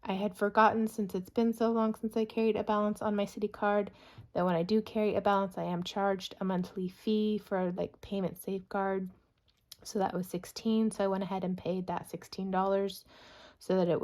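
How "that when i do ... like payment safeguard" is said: ordinary talk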